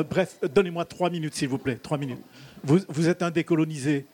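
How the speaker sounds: usual speaking voice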